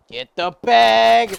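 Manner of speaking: loud, high, drawn-out yell; excited yell. Real, not acted. Player urging a teammate to grab the bag, excited yell. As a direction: yell it with the last word stretched.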